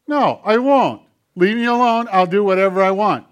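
naughty hand puppet talking back; defiant puppet hand. Acted. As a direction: play the stubborn puppet. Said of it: "nasty little hand talk back".

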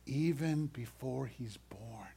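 slow hushed whisper; slow, awed whisper. Acted. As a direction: lean in and breathe it like a secret. Angel's promise told hushed.

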